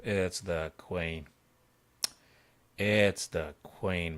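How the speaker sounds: slow and deliberate, "queen" leaned on; slow emphasis. Real, not acted. Streamer correcting his viewers about the piece, slow emphasis. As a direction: spell it out slowly so nobody misses "queen".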